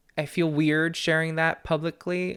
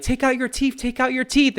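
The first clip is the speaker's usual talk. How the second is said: much higher, playful repeated urging; teasing demand; 11 semitones higher, 4 dB louder than usual. Retold or imitated. Granddaughter teasing grandma to remove teeth, playful high chant. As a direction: coax grandma like a giggling kid.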